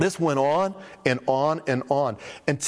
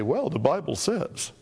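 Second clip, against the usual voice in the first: patient pastor answer; 2 semitones higher, slower than usual. Retold or imitated. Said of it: "And I'd say".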